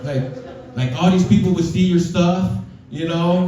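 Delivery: lightly voiced daughter; light daughter impression; mock daughter voice. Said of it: "It's like".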